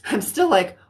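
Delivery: ordinary delivery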